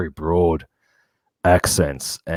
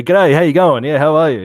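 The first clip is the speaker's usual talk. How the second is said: loud, higher-pitched Aussie greeting; broad Australian accent; 8 semitones higher, 8 dB louder than usual. Retold or imitated. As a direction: lay the Aussie accent on thick. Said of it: "They just couldn't believe how I sounded".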